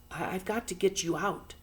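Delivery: put-on a boy stammering it out; boy's voice. A narrator's reading. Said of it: "said the boy".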